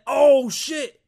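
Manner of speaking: loud, high cry of shock; startled discovery. Acted. First person to see a gorilla, startled discovery. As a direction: blurt it out at the sight of a beast. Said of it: "who was the first person that said".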